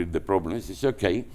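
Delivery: ordinary talk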